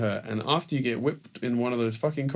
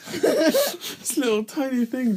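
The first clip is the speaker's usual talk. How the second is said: high, slow, through laughter; laughing; 14 semitones higher, 3 dB louder, much slower than usual. Real, not acted. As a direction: squeal it out while laughing. Laughing at hitting a small child.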